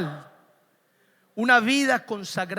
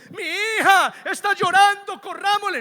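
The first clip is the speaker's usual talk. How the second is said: mock worried parent; 6 semitones higher than usual. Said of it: "no me dejo llevar por el sentimiento".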